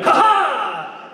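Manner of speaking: high, triumphant "ha ha"; bright burst of fake laughter, held out; mistaken laugh reaction. Voice actor guesses at a scripted reaction, high fake laugh. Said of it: "what you sa-, sound like".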